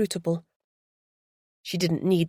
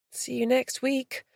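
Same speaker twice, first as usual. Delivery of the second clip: softer, higher faint goodbye; faint farewell; 6 semitones higher, 5 dB quieter than usual. Acted. Beth seeing off the tour group, faint. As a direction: barely get the words out. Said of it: "she said faintly".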